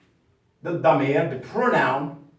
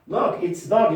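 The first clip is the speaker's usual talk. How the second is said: normal talking voice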